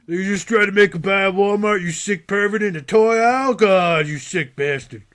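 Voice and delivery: loud, high, hammed-up accusing yell; mock outrage. Police busting a man in the toy aisle, mock outrage. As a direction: berate him like a cop making a bust. Acting police yelling at pervert.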